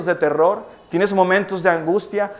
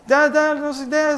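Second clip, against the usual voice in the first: imitating sobbing toddler; 8 semitones higher than usual. Sobbing child's mumbled answer.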